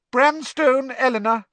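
loud, high roll call; calling out names. A narrator's reading. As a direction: summon a pupil across the hall. Teacher calls out name.

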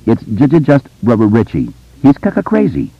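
put-on high-pitched stammering boy; stutter. A narrator's reading. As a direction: catch on the first sounds of words. Stuttering boy's dialogue in narration.

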